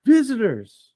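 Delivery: put-on high-pitched kid's call; yelling back. Kid answering the balcony, yelling back. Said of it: "Kid yells back".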